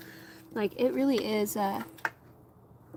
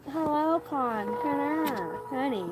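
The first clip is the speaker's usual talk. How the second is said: cooing at dog; 4 semitones higher than usual. Real, not acted.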